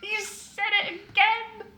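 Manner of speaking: high squeak fading to a wheeze; squeal, then run out of breath laughing; helpless laughter. Acted. Prisoner teases villain about the name, wheezing with laughter.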